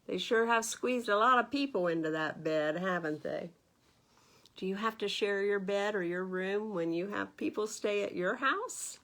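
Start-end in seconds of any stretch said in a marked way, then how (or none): none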